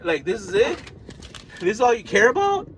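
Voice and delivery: normal talking voice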